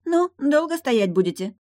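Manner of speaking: high, loud, nagging mermaid voice; impatient mermaid. Acted. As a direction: hurry them along, pitched high. Mermaid hurries them.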